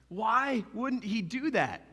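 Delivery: slow, drawn-out question; slow reflective repeat. Shown as a demonstration. Preacher repeating the question slowly so the crowd reflects. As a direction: let it sink in, slowly. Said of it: "Ask yourself that".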